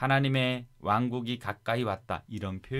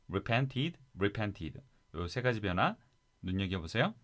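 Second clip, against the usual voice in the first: ordinary talk, nothing marked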